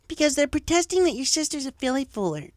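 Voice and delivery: high snide filly cutting in; rude interruption. Acted. Bully filly interrupts teacher, high and snide. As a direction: sneer it out as the class bully. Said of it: "Diamond Tiara rudely broke in".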